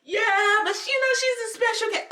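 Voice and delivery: high-pitched mocking imitation; whiny high voice making excuses; protesting teacher. Teacher makes excuses to a parent, protesting.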